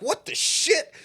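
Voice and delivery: high shocked exclamation; startled. Retold or imitated. Driver startled at his window, mock shock. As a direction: jump at the face in the window.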